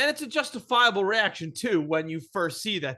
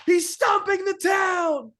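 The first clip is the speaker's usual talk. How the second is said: high, loud, slow alarm cry; mock panic, yelling a warning; hammed-up terrified yell; panicked shout; 12 semitones higher, 6 dB louder, slower than usual. Kent panicking at the giant.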